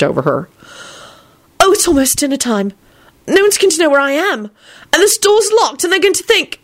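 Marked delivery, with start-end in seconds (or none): 1.6-6.6 high-pitched panicky outburst; frantic. A narrator's reading. Elizabeth realising it's nearly dinner time, frantic. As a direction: burst out in climbing, frantic pitch. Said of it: "she burst out frantically".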